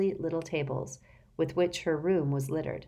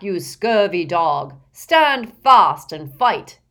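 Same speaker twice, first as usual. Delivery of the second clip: loud, slow yelling knight; 3 semitones higher, 12 dB louder, slower than usual. A narrator's reading. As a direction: bellow it slowly from behind a visor. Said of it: "yelled Sir Cadogan, in a muffled voice".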